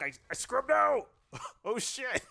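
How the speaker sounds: panicked high shriek, then laughing. Real, not acted. Gamer shrieking at getting scrubbed out. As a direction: yelp in shock as it goes wrong.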